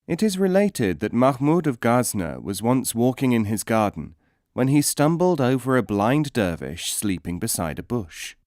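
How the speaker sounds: usual speaking voice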